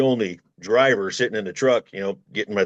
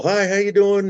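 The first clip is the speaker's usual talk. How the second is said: high sing-song phone greeting; sweet wife checking in; cheery wife on phone; 11 semitones higher, 4 dB louder than usual. Retold or imitated. Wife calls her trucker husband, high cheery greeting.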